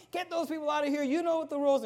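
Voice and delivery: sharp high rebuke at the usher; angry pastor yelling. Retold or imitated. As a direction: call him out in front of everyone. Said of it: "he's just telling him".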